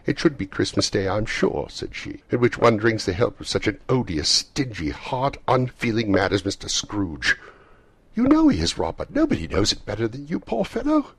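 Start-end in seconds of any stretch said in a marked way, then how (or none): 0.0-1.7 indignant retort. A narrator's reading. Mrs Cratchit retorts crossly. 2.3-7.4 raised voice, each insult hit hard; indignant railing. A narrator's reading. Mrs Cratchit rails against Scrooge. 8.1-11.1 very high, loud protest; exasperated wife. A narrator's reading. Mrs Cratchit appeals crossly to husband.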